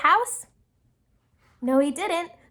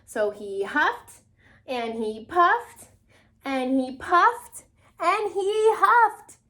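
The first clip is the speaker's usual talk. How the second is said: slow, growing to high and loud; slow dramatic huffing build-up; building huff and puff; 5 semitones higher, 4 dB louder, slower than usual. A narrator's reading. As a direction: draw it out, building each huff. Wolf blowing at the house.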